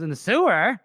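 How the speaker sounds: retold incredulous comeback; incredulous question. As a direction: question it as if you misheard. Acts out a doubting question back.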